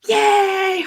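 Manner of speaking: high, loud cheer at the president; excited cheer. Retold or imitated. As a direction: cheer him on from the balcony. Cheering as Trump walks out.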